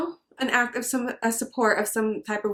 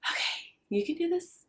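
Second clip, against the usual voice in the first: higher, much softer pep talk to herself; self pep talk; 9 semitones higher, 8 dB quieter than usual. Real, not acted. Bracing herself before telling her story, soft and high. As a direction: psych yourself up under your breath. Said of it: "here it goes".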